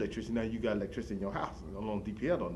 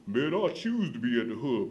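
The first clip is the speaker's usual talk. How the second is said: louder, put-on voice of a boastful hub regular; guy at the hub; 2 semitones higher, 5 dB louder, slower than usual. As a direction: play the bragging older man at the bus stop. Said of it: "He said".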